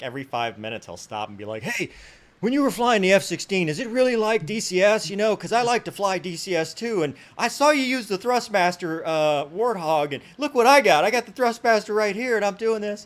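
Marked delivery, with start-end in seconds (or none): 1.6-13.1 high, loud, gushing imitation; excited fanboy. Evicted fan pestering officer about flight sims, excited mock voice. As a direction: chatter eagerly like a starstruck gamer.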